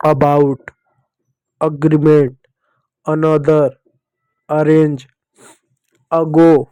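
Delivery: about, agreement, another, arrange, ago said slowly with the a as a short uh; each word set apart, first a spoken as a short uh; reading example words. Shown as a demonstration. Teacher reading English words aloud to pupils, the a as a short uh. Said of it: "इन सभी शब्दों में ए को आप क्या पढ़ोगे".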